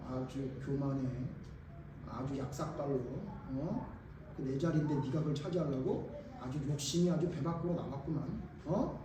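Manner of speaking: low, quiet jealous grumble; acted resentful grumbling. Jealous disciples grumbling over a coveted seat, acted resentment. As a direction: grumble under your voice about a rival. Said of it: "이런 마음으로 여러분 화가 난 거예요".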